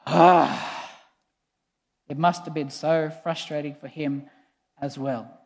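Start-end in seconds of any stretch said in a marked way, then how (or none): 0.1-1.0 heavy acted sigh; weary exhalation, imitating deep frustration. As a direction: let out a long, breathy sigh. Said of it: "sighs deeply".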